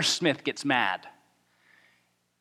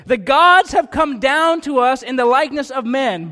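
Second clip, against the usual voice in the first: crowd crying out; 6 semitones higher, 6 dB louder than usual. A narrator's reading. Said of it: "they lifted their voices".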